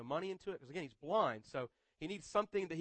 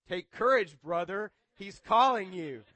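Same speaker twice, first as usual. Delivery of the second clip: encouraging call; 10 dB louder, slower than usual. Acted. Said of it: "are saying".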